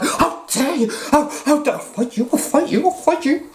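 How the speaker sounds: mock indignant rant. Younger self snapping at people who call David Icke a nutter, hammed-up fury. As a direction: play your younger self ready to fight. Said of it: "Not quite that bad".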